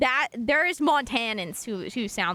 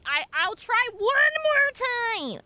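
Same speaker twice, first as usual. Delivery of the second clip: slow, higher, sing-song resolve; drawn-out high determined try; 11 semitones higher, slower than usual. Real, not acted. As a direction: stretch it out, high and playful. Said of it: "I need to progress".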